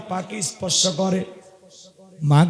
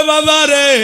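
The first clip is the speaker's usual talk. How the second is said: put-on higher, louder call to the boy; 5 semitones higher, 5 dB louder, slower than usual. Aunt calling to the weeping son, raised voice. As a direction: call out to him, raised and drawn. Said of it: "চাচি ডেকে বলে".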